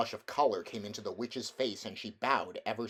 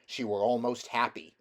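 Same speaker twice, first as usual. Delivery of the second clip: ordinary delivery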